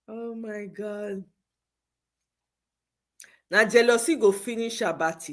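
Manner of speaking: ordinary talk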